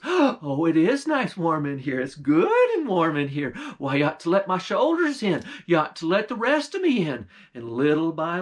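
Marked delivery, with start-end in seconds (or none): 0.0-7.3 pleased witch voice. Acted. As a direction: push for more, cheerfully. Witch warm inside, louder.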